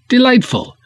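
a high, delighted "Delightful"; the second "Delightful" jumps up high like a thrilled reviewer. Shown as a demonstration. Creator reading his fan's review, echoing it high with delight. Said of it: "repeated Delightful high".

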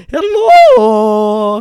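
hammed-up, drawn-out vampire hello; Count Chocula voice. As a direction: give a drawn-out vampire greeting. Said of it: "I'm going to go with this".

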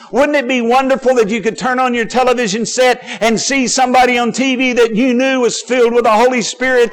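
high, loud, driving sermon delivery; full-force preaching. Real, not acted. Preacher urging the congregation to want anointed preachers, full-force preaching.

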